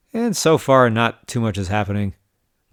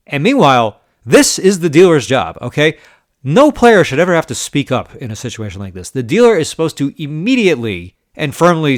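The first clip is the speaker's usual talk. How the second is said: louder and higher, hammering each point; venting at the dealer who let it slide; indignant rant; 4 semitones higher, 6 dB louder than usual. Real, not acted. Vlogger ranting to viewers about the dealer, indignant and louder.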